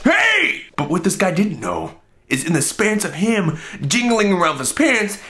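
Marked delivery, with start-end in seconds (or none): 0.0-0.6 very high, sudden "hey"; mimicked startling shout. Guy bursting into the next stall, mimicked high shout.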